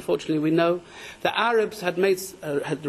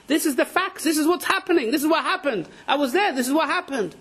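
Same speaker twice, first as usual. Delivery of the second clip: insisting; 8 semitones higher than usual. Retold or imitated. Student insisting he reported facts.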